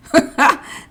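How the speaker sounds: a loud, high bark of laughter; high burst of laughter. Real, not acted. Reacting to police post.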